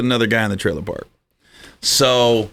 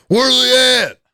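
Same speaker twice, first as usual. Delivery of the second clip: mock angry yell; 10 semitones higher than usual. Angry boyfriend voiced, high loud.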